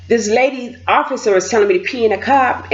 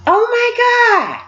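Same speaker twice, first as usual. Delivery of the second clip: high, drawn-out mock despair; exasperated wail; 13 semitones higher, slower than usual. Sat down and could not pee, exasperated. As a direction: draw out a pitched-up lament. Mortified reliving failed attempt.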